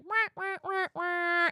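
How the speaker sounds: high whiny wah wah; mock sad trombone. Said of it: "one eye looks like".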